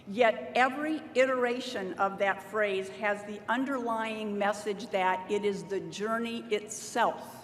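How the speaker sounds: ordinary talk, nothing marked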